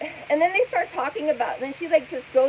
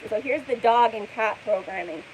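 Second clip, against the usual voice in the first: usual speaking voice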